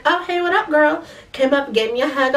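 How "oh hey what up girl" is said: casual guy greeting. Retold or imitated. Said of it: "He was like".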